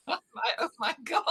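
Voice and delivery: breaking into high laughing shrieks; real laughter. Real, not acted. Recalling husband's antics, she laughs out loud. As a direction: let the laugh run away with you. Laughing at her own story.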